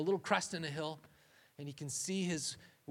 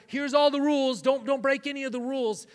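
mock strict rule-giver; 7 semitones higher, 10 dB louder than usual. Mocking rule-giving God.

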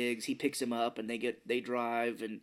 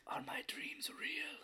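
soothing reading voice; 11 dB quieter, slower than usual. Retold or imitated. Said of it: "he's like".

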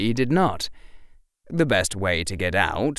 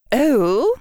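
put-on high, wary old witch; stern witch, suddenly guarded; odd tone; 6 dB louder than usual. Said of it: "in an odd tone".

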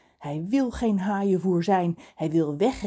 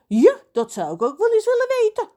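high, loud indignant outburst; 7 dB louder, faster than usual. A narrator's reading. John backs up Reus against Lorelei, indignant. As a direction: demand an answer, voice shooting up. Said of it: "zegt John verontwaardigd".

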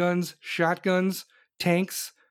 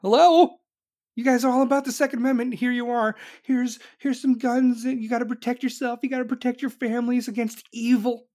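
mock press outcry; 6 semitones higher than usual. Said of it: "everybody in the press was like".

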